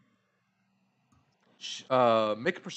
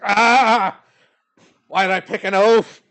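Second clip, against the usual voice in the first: loud, higher cry of frustration; exasperated elf; 5 semitones higher, 10 dB louder, slower than usual. Acted. Elf woman annoyed at her oafish partner, loud and exasperated. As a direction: groan at the clumsy partner, loudly. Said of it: "She's like".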